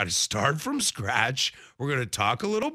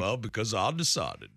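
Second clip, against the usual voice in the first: lower-pitched, put-on Texan twang; Texas accent; 5 semitones lower than usual. Host justifying the impression to co-host, Texas accent. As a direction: keep up the mock Texas accent. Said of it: "Why am I from Texas".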